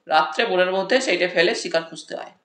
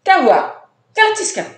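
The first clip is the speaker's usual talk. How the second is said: much higher, louder irritated snap; snapping irritably; 10 semitones higher, 6 dB louder than usual. A narrator's reading. Kyabla snaps at the friend who poked him, higher and louder. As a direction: bite back after being poked. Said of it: "খ্যাচখ্যাচিয়ে উঠল".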